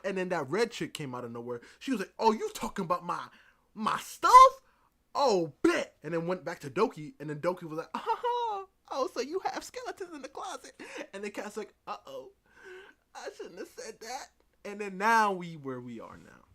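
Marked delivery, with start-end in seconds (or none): 2.2-5.9 loud burst with a high peak; mock confrontation. Red chick confronting Kat about her stuff, loud mock challenge. 7.9-10.7 very high, loud, laughing-edged taunt; mock gloating discovery. Doki finding Kat's skeletons, high mock-gloating voice. 11.9-14.3 small high-pitched regret; mock oops. Kat caught out by Doki, high mock-sheepish voice.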